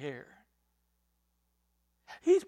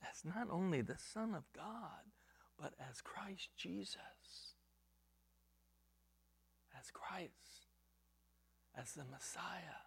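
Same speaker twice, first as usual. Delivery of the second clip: whisper; 15 dB quieter than usual. Real, not acted. Intimate climax of the sermon.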